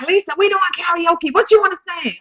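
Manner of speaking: high, loud excited cousin. Retold or imitated. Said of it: "She's like".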